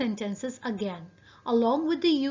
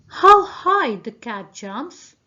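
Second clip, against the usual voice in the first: louder, slow, amazed reading; exclamatory reading; 11 dB louder, slower than usual. Shown as a demonstration. Teacher reading an example sentence to students, loud slow amazement. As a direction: read it out slowly, marvelling at the jump. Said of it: "known as exclamatory sentences".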